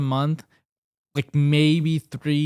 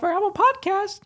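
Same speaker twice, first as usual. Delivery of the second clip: high-pitched. Real, not acted. Sudden high squeak mid-plug.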